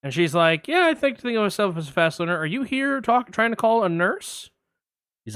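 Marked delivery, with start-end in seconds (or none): 0.7-4.5 higher, brighter receptionist voice; mock receptionist, pitched up. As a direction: pitch her up like a flustered front desk. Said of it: "I'm gonna play both parts".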